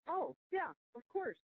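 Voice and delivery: high, casual "oh yeah"; breezy agreement. Retold or imitated. Board members shrug off her claim, light and high. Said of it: "they were like".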